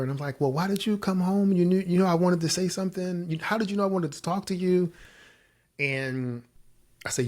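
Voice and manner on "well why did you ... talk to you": high-pitched, eager acted questions; excited surprise. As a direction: act out bubbling, flustered excitement. Acting out excited surprise to wife.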